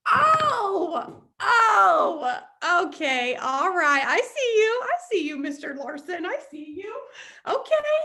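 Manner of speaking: high, loud burst of delighted understanding; sudden realization. Retold or imitated. Student finally understands the teacher, excitedly calling out. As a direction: cry out like the penny just dropped.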